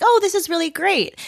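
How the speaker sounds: high, bright burst of delight; thrilled the dog likes her. Retold or imitated. Retelling meeting the dog, she squeals in delight. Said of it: "and I was like".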